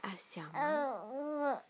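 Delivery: hushed, slowed-down whisper. Real, not acted. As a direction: breathe it slowly. Mother whispers to baby.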